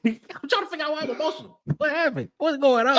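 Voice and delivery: baffled laugh. Real, not acted. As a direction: laugh it off in confusion.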